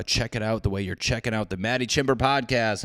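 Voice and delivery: ordinary talk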